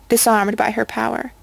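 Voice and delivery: plain reading voice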